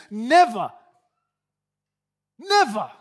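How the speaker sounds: loud, falling double never; flatly refuse, hammering each never; emphatic refusal. Retold or imitated. Peter refusing Jesus washing his feet, loud and firm. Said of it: "Peter said to him".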